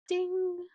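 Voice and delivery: high bright ding sound; playful ding. Pretend. Pointing at her laptop for viewers, a playful high ding. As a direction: chime it out high like a bell. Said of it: "winking and pointing towards my laptop".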